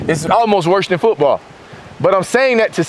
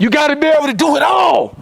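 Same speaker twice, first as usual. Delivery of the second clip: high, hollered punchline; emphatic shout; 7 semitones higher than usual. Acted. Speaker urging young players to do everything, shouting high. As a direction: drive it home in a high shout. Carries on the locker-room shout.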